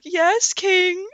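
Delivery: high, meek yes-man voice; lightly mocking obedient servant; servile worker. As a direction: squeak it like a toady. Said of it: "the worker's like".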